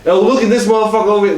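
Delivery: high, loud, quick imitated heckle; single someone out with a rowdy jeer; teasing call-out. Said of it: "someone just randomly just like".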